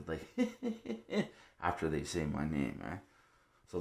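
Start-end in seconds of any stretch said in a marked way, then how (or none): 0.3-1.2 high, soft imitated snicker; mocking little laugh. Imitating the laugh after they say his name, high mocking snicker. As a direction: mimic their little laugh, pitched way up. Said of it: "they do a little laugh after".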